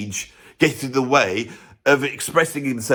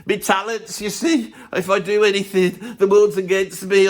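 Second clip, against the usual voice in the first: a light whiny sulk, a bit higher and louder; mock a self-pitying goth star; hammed-up poor-me moan; 5 semitones higher, 3 dB louder than usual. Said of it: "Oh, Edward Scissorhands".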